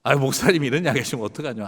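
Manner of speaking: fast, playful protest; mock scolding. Elder teasingly scolding the pastor's joke, fast.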